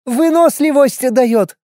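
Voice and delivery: put-on high, slow, proud little girl; child voice. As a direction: announce it like an eager kid. Child offers bun.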